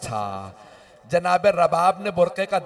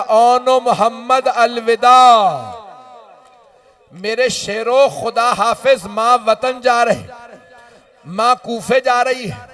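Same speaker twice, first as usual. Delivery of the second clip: higher, louder, slow grieving cry; wailing farewell; 4 semitones higher, 7 dB louder, slower than usual. Acted. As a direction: bid the dead sons goodbye in a keening wail. Mother's farewell to dead sons. (ऊँचा, ज़ोरदार, धीमा विदाई का मातम)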